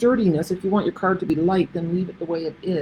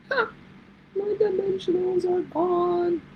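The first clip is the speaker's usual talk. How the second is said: mock dismay; 12 semitones higher, 4 dB quieter, slower than usual. Real, not acted. Lost dimensionals, mock dismay.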